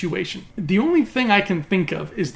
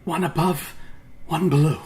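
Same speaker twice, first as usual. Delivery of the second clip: intense villain quote. Acted. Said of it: "say it with the same intensity that he did".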